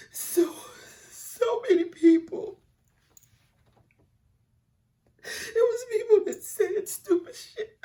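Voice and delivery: high, slow, breaking sobs; crying. Real, not acted. Remembering people who said she helped them, crying. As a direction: voice cracks into tears.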